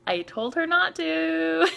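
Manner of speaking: drawn-out, high-pitched self-defence; high protest. Retold or imitated. Explaining herself to the tour manager, high protesting. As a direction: squeak out a slow, innocent protest.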